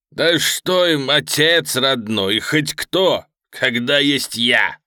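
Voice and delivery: loud, slow, emphatic sneer; contemptuous villain, boastful. Acted. Pudovochkin scorns his hostages' hopes, loudly contemptuous. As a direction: hammer each phrase with swaggering disdain.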